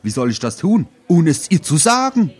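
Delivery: acted whiny protest, climbing steadily. Harry protests, building to outburst.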